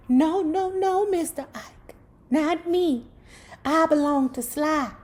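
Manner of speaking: mock frightened young girl begging off; scared girl refusing.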